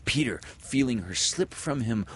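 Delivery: ordinary delivery, nothing marked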